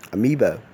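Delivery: Amoeba said uh-MEE-buh; correct pronunciation. Shown as a demonstration. Teacher modelling the right form. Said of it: "It's rendered as".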